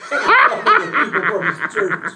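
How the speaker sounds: loud, high, drawn-out burst of laughter; laughing hard. Real, not acted. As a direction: crack up at your own story. Punchline of his own story.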